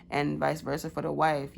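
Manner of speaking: ordinary talk, nothing marked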